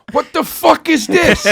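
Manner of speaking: high, loud mock indignation, drawn out; retold outrage. Retold angry friend in story.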